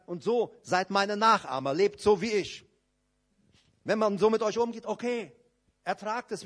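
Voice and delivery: lauter, höherer Predigerton, eindringlich; leidenschaftliche Predigt. Acted. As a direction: predige mit voller Überzeugung zur Gemeinde. Predigt mit voller Kraft. (zur Gemeinde: laut und höher, eindringlich gepredigt)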